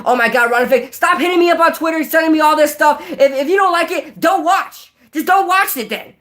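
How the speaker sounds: high-pitched mock rant at the commenters; hammed-up defensive YouTuber, whiny high voice, stumbling over words; Ron Effect. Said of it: "I can't do his voice".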